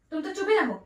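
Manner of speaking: ordinary talk, nothing marked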